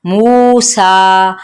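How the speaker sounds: मूसा slowly, both मू and सा held long; equal stretching. Shown as a demonstration. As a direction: stretch मू and सा evenly. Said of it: "दोनों को equal खींचना है". (मू और सा दोनों बराबर खींचें)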